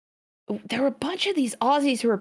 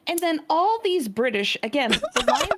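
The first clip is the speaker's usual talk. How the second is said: mild mock outrage; 8 semitones higher, 5 dB louder than usual. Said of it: "it fucking sounds like a slur when you say it".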